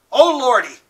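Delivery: nearly in tears, shocked; catch in voice. A narrator's reading. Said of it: "There was a catch in Two-Bit's voice".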